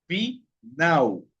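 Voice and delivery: final split in two, "fi... nau"; final with a pause before "nau". Shown as a demonstration. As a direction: sound out final by syllable, the L as u. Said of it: "se pronuncia la L como la U".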